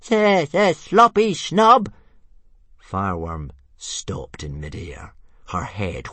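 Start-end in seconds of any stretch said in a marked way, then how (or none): 0.1-1.9 high, slow, louder stuttering jeer; taunting little dragon. A narrator's reading. Tiny dragon calling a taunt at Fireworm, high and loud. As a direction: call the taunt out as a cheeky dragon. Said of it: "Toothless called out".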